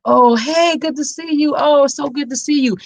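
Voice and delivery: gushing hello, put on for company; sweet public act. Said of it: "the sweetest thing ever in front of everybody".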